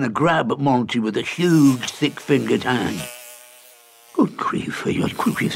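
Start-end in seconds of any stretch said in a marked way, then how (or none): 4.1-5.5 breathless high gasp of alarm; gasped-out fright; startled exclamation. A narrator's reading. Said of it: "exclaimed Monty".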